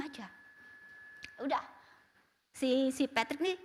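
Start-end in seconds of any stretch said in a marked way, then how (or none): none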